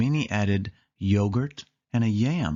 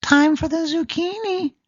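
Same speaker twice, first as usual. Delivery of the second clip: much higher, louder mouse voice; Minnie voice; 6 dB louder than usual. Acted. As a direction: announce it as Minnie. Minnie adds zucchini.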